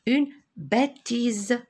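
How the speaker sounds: BÊTISE slowly stretched with a buzzing Z; slow teacher's model of "bêtise", voiced Z at the end; sounded-out Z. Shown as a demonstration. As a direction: draw out "bêtise", letting the final S buzz as Z. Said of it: "j'entends le son Z".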